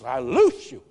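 loud sudden healing command; commanding deliverance. Retold or imitated. Preacher voicing himself freeing the boy, loud command. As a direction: bark it like casting out.

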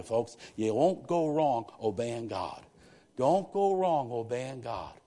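slow, drawn-out exhortation; slow emphatic appeal. Real, not acted. Preacher urging the congregation to obey God, slow and emphatic. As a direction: lean on every word.

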